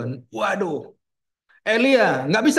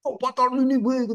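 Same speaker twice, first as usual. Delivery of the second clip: angry cry; 6 semitones higher, slower than usual. Retold or imitated. Reluctant Jonah crying out to Nineveh, mock-angry. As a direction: imitate an angry shout at normal volume. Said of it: "teriak dengan teriak yang betul-betul marah".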